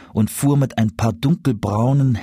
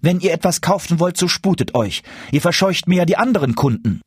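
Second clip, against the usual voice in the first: quick, higher-pitched impatient woman; displeased mother; 8 semitones higher, faster than usual. A narrator's reading. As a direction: hurry the customer along testily. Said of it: "sagte endlich die Frau des Schusters in Unmut". (unmutig drängende Frau, schnell und höher)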